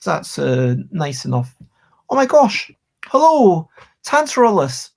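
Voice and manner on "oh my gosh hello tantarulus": high, loud, delighted welcome; excited greeting. Real, not acted. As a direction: greet the raider with a burst of joy. Raider joins the stream.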